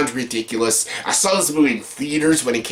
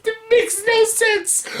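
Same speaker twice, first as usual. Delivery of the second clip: mock confused viewer, much higher, slowing on the last words; baffled viewer; slower than usual. Viewer at the movie's ending, mock baffled and much higher. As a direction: play the lost moviegoer, squeaky and baffled. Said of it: "you're like".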